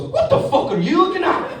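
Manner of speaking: put-on high, snapping Scottish child voice; angry Scottish girl. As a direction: tell off a stranger like a fierce little Glasgow girl.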